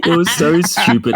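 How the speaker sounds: laughing through the words; amused laugh over the words. Real, not acted. Laughing about silly scene.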